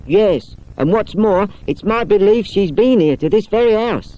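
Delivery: put-on a companion politely turning boys away; Miss Wardle.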